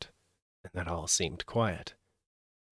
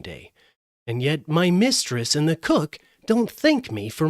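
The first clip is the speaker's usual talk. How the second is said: higher, louder rooster voice, indignant about his fate; proud cock voice; 7 semitones higher, 6 dB louder than usual. Acted. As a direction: crow it out like a worried rooster. The cock character speaks.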